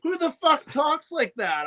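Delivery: high-pitched mock disbelief; retold incredulity. Retelling a reaction to the fake accent, mock incredulous. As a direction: retell it as a baffled objection. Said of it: "And I was like".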